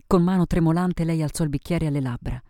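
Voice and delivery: ordinary delivery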